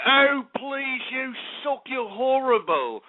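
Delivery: slow, lightly mocking talent-show judge put-down; Simon Cowell. Host imitating a harsh judge dismissing a caller, slow and sneering. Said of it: "If I was Simon Cowell, I'd be like".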